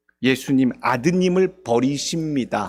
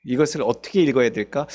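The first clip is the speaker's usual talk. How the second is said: ordinary talk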